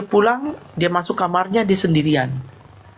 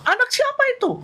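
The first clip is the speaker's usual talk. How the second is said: a much higher, indignant retold question; sudden angry outburst; faster than usual. Grieving relative lashing out at a guest's running child, high angry outburst. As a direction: bark it at the running child, pitch jumping way up. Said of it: "tiba-tiba ngamuk".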